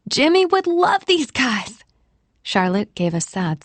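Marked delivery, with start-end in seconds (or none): 0.1-1.8 high, drawn-out excited holler. A narrator's reading. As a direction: call out over the pub music. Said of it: "Kara hollered across to Charlotte".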